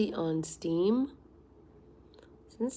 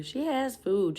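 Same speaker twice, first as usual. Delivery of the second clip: has stressed, louder with a fall; 4 semitones higher, 5 dB louder, slower than usual. Real, not acted. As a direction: insist she has food. Arguing cat has food.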